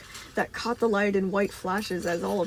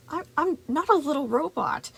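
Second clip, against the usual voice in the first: put-on high, indignant child voice; annoyed arguing; 7 semitones higher, 4 dB louder than usual. A narrator's reading. Boy argues with Moon, annoyed and high-pitched. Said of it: "Gregory argued, a strange flare of annoyance".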